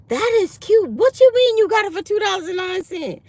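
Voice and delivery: high, loud admiring disbelief; amazed coworker. Retold or imitated. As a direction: gush over the dress. Coworker amazed at cheap outfit.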